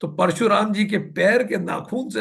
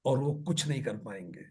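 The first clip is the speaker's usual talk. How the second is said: ordinary talk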